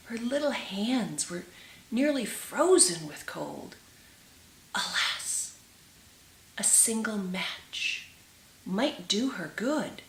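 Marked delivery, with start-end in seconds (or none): none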